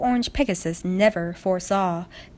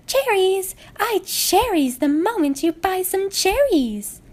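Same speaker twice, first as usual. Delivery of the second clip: market shouting; 11 semitones higher, slower than usual. A narrator's reading. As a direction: sing out your cherries to the crowd. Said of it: "Ponies were shouting".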